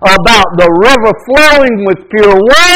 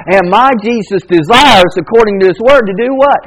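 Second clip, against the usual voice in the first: ordinary talk, nothing marked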